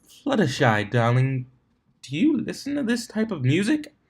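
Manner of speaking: posh lady voice. Acted. Rarity asks Fluttershy.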